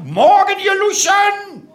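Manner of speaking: high, drawn-out bellowed greeting; stretched parade-ground shout; drill-sergeant yell. Acted. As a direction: roar it at the crowd like a drill instructor. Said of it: "brüll die Leute an".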